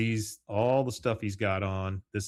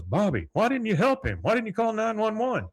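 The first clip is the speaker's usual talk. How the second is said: exasperated scolding; 12 semitones higher than usual. Retold or imitated. Retold father scolding his son.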